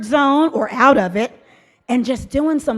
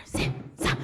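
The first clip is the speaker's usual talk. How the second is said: whisper; 11 dB quieter than usual. Shown as a demonstration. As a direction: still whispered chant. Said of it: "Say it louder".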